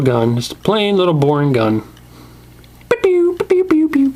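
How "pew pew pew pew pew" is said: high pitched pew pew zaps; play-shoot with the toy gun; squeaky mouth gun sound effects; toy gun noises. Pretend. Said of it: "That's definitely the noise it makes".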